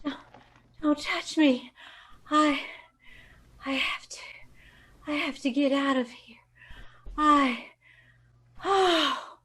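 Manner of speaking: weak whisper fading out; barely whisper, strength gone; drugged whisper. Acted. Drugged secretary whispering her plan to flee. Drugged victim trying to escape.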